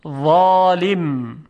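ظالم slow and loud, thick emphatic th; heavy za sound. Shown as a demonstration. Teacher drilling the coarse sound, heavy drawn-out ظالم. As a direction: raise the back of the tongue through ظالم. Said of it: "این وا صداش درشته".

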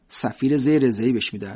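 usual speaking voice

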